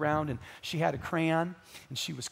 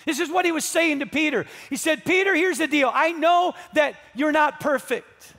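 loud, high pulpit voice speaking as a forgiving Jesus; fervent, emphatic preaching at full force; impassioned preaching; 12 semitones higher, 10 dB louder than usual. Acted. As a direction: proclaim it in building waves, stretching the key phrases.

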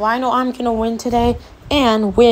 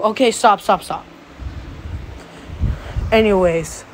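usual speaking voice

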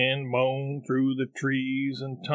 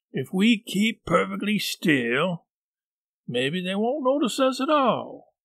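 high nervous hush; scared but reassuring; 6 semitones higher than usual. Acted. Tom hushed reassurance in fear.

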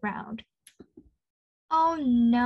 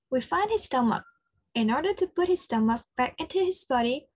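normal talking voice

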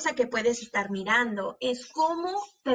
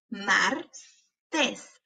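"Martes" slowly as mar, pause, tes; broken into syllables. Shown as a demonstration. Teacher helping hear each sound, syllable by syllable. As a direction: break "Martes" in two. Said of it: "quiero que las quiebres entre sílabas".

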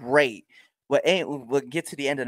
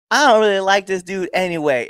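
louder, higher retold crowd jeer; heckler's call; 7 semitones higher, 6 dB louder than usual. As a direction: call it out like a sceptical fan. Heckler quote shouted from the crowd.

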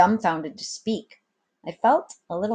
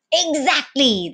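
a lightly raised, drawn-out exclaim; exclaiming captain; 7 semitones higher, 5 dB louder than usual. A narrator's reading. The captain confirms the boy's guess, mildly triumphant. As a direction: hit it with mild triumph. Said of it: "the captain exclaimed".

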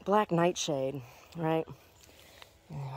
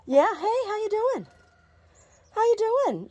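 pet voice. Real, not acted. Owner cooing at her hen Snooty Bird, very high baby talk. Greeting chicken.

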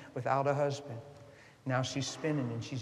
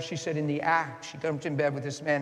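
usual speaking voice

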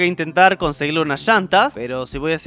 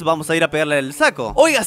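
usual speaking voice

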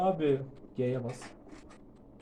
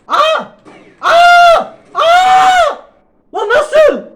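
high, loud screams of outrage; shrieking in shock; 14 dB louder, much slower than usual. Real, not acted. Gamer losing a round, shrieking in shock. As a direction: scream at the screen repeatedly. Killed again in game.

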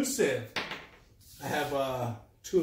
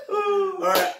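high laugh; 7 dB louder than usual. Real, not acted. Laughing after being startled.

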